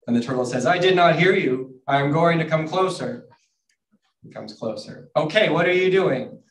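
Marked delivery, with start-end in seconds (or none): 0.7-3.3 calling out. A narrator's reading. Turtle calls that it can't hear. 5.2-6.4 calling out. A narrator's reading. Turtle asks again from nearer.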